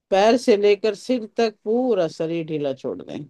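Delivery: normal talking voice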